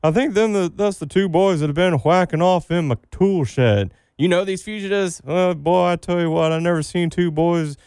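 put-on podcast host plays a backwoods farmer accusing two boys; hillbilly shed owner.

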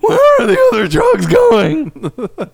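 very high, slow squeaky voice; practiced squeaky voice. Pretend. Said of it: "I practiced on that one".

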